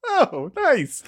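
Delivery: high, lilting retold "oh, nice"; pleased reaction. Acting out own pleased reaction.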